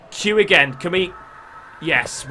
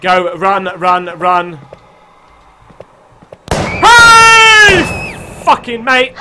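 being chased; 2 semitones higher, 9 dB louder, much slower than usual. Real, not acted. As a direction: yell in panic while running.

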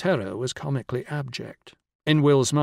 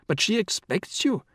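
high-pitched, amazed protest; incredulous amazement; 8 semitones higher than usual. A narrator's reading. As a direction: protest in sheer disbelief. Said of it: "cried in a tone of incredulous amazement".

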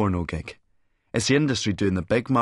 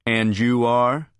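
slow, loud, haughty query; snooty, stretched-out challenge; 3 semitones higher, 5 dB louder, slower than usual. A narrator's reading. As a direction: draw it out with a superior rising tone. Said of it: "asks haughtily".

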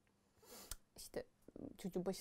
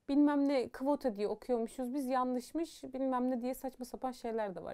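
ordinary delivery

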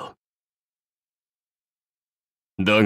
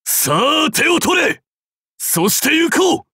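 high, impassioned warlord's cry; rallying shout; 12 semitones higher than usual. Acted. Warlord calling his master to the journey, rallying shout. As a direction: call out at full voice, pitched far up.

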